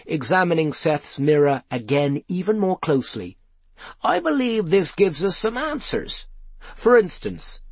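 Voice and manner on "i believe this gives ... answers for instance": high, excited character voice; eager discovery, pitched well up. A narrator's reading. Pewter thinks the mirror gives answers, excited. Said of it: "cried Pewter excitedly".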